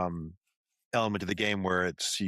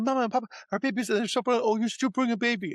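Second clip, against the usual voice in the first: much higher, gushing parent voice; thrilled parent. Retold or imitated. Sketch parent gushes over the baby in a much higher voice. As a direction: gush over the talking baby. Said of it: "Like, I said".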